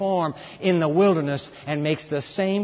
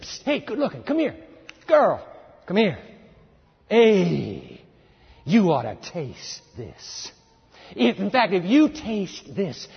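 high, slow, loud seductive snake voice; sly tempter; 5 semitones higher, 4 dB louder than usual. Retold or imitated. Satan luring Eve at the tree, sly and seductive. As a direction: coax her like a smooth-talking tempter. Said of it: "He said".

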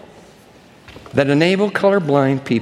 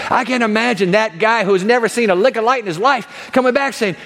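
ordinary delivery

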